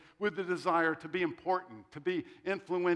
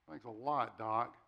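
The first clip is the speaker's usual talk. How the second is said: low, soft deadpan comeback; dry sarcasm; 6 semitones lower, 5 dB quieter, slower than usual. Retold or imitated. Patient answering doctor's heart attack prediction, dryly sarcastic. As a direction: mutter mock thanks, deflated.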